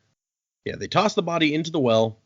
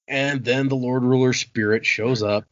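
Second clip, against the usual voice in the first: ordinary delivery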